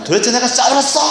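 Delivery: high-pitched mock scolding; scolding parent. Parent grilling the child who got hit, high mock scolding. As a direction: demand an answer, voice jumping up high.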